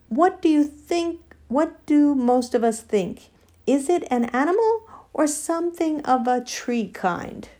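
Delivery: high, questioning elephant voice; elephant chairing debate. Acted. Elephant asking the animals for their verdict, questioning.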